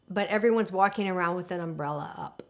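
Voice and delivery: ordinary delivery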